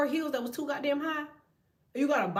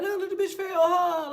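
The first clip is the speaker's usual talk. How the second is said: put-on higher, louder, gleeful kids spotting a fall; excited kids pointing; 6 semitones higher, 5 dB louder than usual. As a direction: point and crow like a thrilled kid. Kids watching skaters fall on TV.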